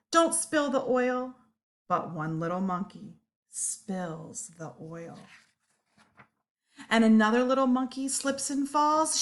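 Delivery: ordinary delivery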